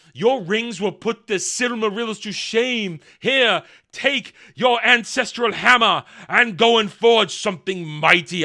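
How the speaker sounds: loud, grandiose mock exhortation; Sauron. The deceiver sending the smith back to forge, booming mock grandeur. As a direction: declaim it with theatrical pomp. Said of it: "Here comes Sauron and says".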